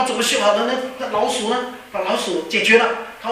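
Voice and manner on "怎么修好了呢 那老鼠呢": higher-pitched, acted incredulous questions; mock-indignant questioning, pitched up; disbelieving challenge. Pilot challenges the mechanic's claim.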